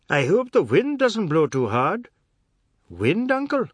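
high, loud, mocking character voice; jeering uncle, voice swooping up; teasing uncle. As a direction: needle them with hooting mock concern.

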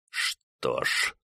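soft grated words through teeth; through gritted teeth. Acted. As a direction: grind it out through clenched teeth. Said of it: "скрипнул зубами генерал".

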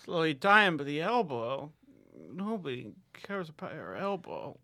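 high, odd put-on voice of a wounded photographer; whiny character voice. Wounded photographer complaining to interviewer, high whiny voice. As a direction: play the disappointed, sedated hipster.

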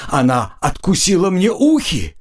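high, wailing complaint of a hurt orc; pained cry. A narrator's reading. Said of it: "кричал он". (жалобно кричать высоким голосом от боли)

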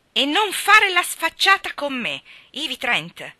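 loud, high, slow scolding; furious. A narrator's reading. Gerald warns Ivy not to be cheeky, furious. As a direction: warn her off, stretching the words angrily. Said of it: "gridò Gerald furibondo".

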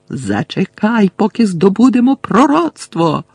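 yelling. Acted. Malfoy yells at Bellatrix to wait for the prophecy. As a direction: roar to stop her. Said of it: "горлав Мелфой".

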